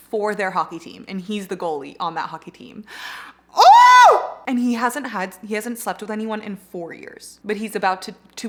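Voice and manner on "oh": very high, loud "oh"; piercingly high burst of excitement; fangirl squeal. Real, not acted. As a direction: squeal with overwhelmed delight. Swooning over the romance setup.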